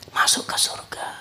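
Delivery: soft echoing whisper. Acted. Preacher whispering the verdict to the congregation. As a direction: repeat it under the breath.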